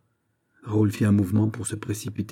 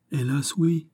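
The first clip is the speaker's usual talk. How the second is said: higher, sorrowful lament; plaintive cry; 8 semitones higher, slower than usual. A narrator's reading. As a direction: cry it out as a poor distressed girl. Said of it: "s'écria le pauvre enfant". (cri plaintif)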